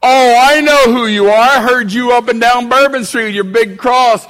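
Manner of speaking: mocking street preacher. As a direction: ham up a street preacher's cry. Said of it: "And I said".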